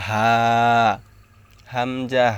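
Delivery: হা and হামজা from the start of the throat; careful letter demonstration of হা and হামজা. Shown as a demonstration. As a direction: say হা, then হামজা, both made in the same throat spot. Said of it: "দুইটা অক্ষর একই জায়গা থেকে".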